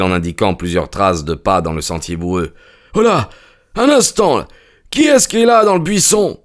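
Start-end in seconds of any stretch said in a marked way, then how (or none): 2.9-6.4 calling out. A narrator's reading. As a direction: call out to whoever hides. Stranger calls out into bushes.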